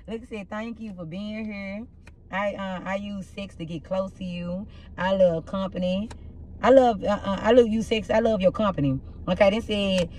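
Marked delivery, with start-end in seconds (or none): 0.1-4.7 very soft, slow delivery; hushed. Pretend. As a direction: drop right down and take it slowly. Drops voice reading the cards.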